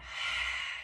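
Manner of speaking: short airy "haaa" exhale; whispered sigh. Shown as a demonstration. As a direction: breathe out a whispery fogging-the-mirror sound. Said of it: "that whisper sound".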